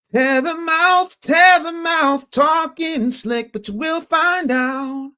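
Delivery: high drawn-out mocking chant; high sing-song taunt. Host taunting a chat commenter, high slow mocking chant.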